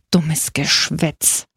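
put-on slow, dismissive old man's grumble; grumbling. A narrator's reading. Old recruit dismissing the joker's talk, grumbled slowly. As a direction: grumble it out very slowly, dragging each word. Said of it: "brummte der Alte".

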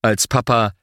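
plain reading voice

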